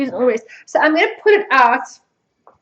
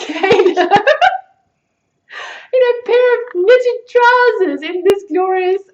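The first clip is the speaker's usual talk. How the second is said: high, loud, laughter-filled telling; real helpless giggles; 6 semitones higher, 6 dB louder than usual. Real, not acted. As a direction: barely get it out for laughing. Cracking up imagining child in trousers.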